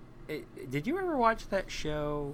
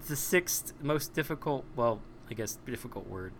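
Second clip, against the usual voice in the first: ordinary delivery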